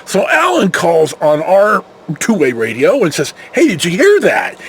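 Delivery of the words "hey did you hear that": ordinary talk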